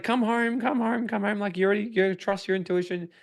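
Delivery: high, pleading girlfriend voice, imitated. Girlfriend begging him home, high pleading imitation. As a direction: mimic her begging him to come home. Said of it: "and she's like".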